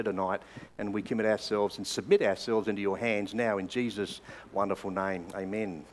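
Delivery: soft, earnest spoken prayer. Real, not acted. Pastor praying to God, quiet and earnest. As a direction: pray quietly before the sermon.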